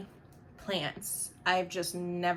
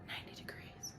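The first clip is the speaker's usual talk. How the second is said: whisper; 18 dB quieter than usual. Real, not acted. Repeats in whisper.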